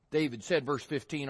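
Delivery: normal talking voice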